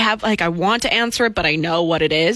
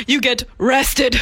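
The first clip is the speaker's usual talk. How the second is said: robot voice; 4 semitones higher, slower than usual. Retold or imitated. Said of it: "I like".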